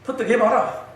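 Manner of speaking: high, loud offhand Gyeongsang retort; 시큰둥한 경상도 말투; indifferent Gyeongsang husband. Retold or imitated. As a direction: brush her off in Gyeongsang dialect. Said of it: "시큰둥하게 대답합니다".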